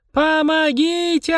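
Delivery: very high, drawn-out howl for help; child's wail. A narrator's reading. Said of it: "раздался детский вой". (протяжный детский вой)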